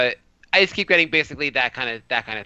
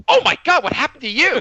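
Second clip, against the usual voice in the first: loud, high horrified outburst, acted out; mock shock; 10 semitones higher, 5 dB louder than usual. Acquaintance spotting his nose at the supermarket, shocked. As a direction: imitate someone aghast at the sight of you.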